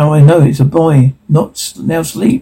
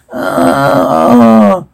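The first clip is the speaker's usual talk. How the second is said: long, higher "aaah"; drawn-out battle yell; 4 semitones higher than usual. Acted. Reader voicing warriors amid clanking weapons, long mock yell.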